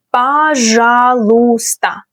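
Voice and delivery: Пожалуйста drawn out slowly, each syllable clear; slow demo. Shown as a demonstration. Teacher to learners, Пожалуйста slowly and clearly.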